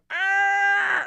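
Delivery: long high-pitched held scream; loud sustained mock battle scream; screaming orc. Screamer orc starting a fight, full-out scream. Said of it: "the man who just screams".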